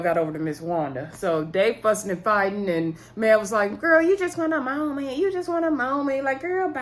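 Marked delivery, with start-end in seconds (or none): 3.8-6.8 high-pitched dismissive imitation; mocking taunt. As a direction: act out a taunt in a raised pitch, repeating it dismissively. Retold taunt during an argument.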